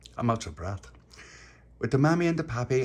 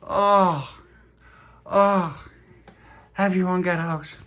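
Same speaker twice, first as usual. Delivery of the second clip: high, loud urgent order; telling everyone to get out, loud; high loud call; 8 semitones higher, 7 dB louder, much slower than usual. Acted. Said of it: "And he went".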